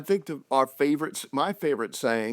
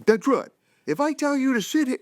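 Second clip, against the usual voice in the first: higher, firm drill-sergeant dad voice; commanding dad; 12 semitones higher than usual. Retold or imitated. Dad drilling his little kids on obedience, mock stern. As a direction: bark the question like a playful drill sergeant.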